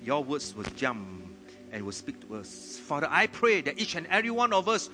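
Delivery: loud, high prayer over the congregation; fervent prayer. Real, not acted. Pastor praying over the church, fervent and loud. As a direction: pray out with rising fervour.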